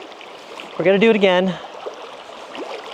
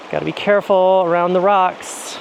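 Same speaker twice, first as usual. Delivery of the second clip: ordinary delivery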